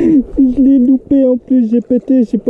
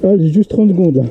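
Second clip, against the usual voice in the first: usual speaking voice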